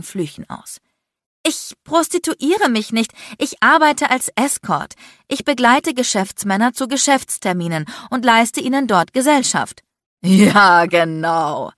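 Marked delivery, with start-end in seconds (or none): 1.5-9.8 higher, louder, heated self-defence; indignant denial. A narrator's reading. Accused woman explains her escort job, indignant. 10.2-11.7 loud, drawn-out mock agreement; sarcastic scoff. A narrator's reading. Man mocks the woman's escort explanation, sarcastic. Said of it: "lachte Landon sarkastisch".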